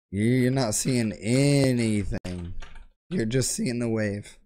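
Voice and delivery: ordinary delivery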